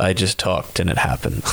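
low, dry deadpan voice; Stephen Wright deadpan. Retold or imitated. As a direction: say it low and unimpressed. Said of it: "He's like".

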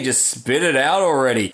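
ordinary delivery

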